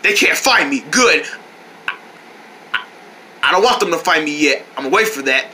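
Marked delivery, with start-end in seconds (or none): none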